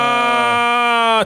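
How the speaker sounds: long, high mock wail; drawn-out imitated cry of distress; imitated scream. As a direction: hold a high put-on scream. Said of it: "Jag vet exakt vad det är för skrik".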